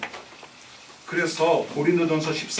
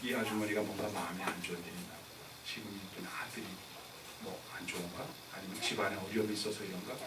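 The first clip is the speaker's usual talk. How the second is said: low, very soft wondering to oneself; quiet inner musing; 11 semitones lower, 18 dB quieter than usual. Acted. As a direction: think it through under your voice. Imagined sympathetic inner musing.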